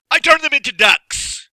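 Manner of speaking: high, very loud bellow. Acted. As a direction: bellow it triumphantly. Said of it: "bellowed Gasazi".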